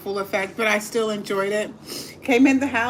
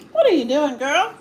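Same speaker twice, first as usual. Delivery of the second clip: talking to the dog; 6 semitones higher, 5 dB louder than usual. Real, not acted.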